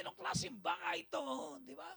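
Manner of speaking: high shouted outburst; mock angry yell. Angry spouse yelling about the house, high shout.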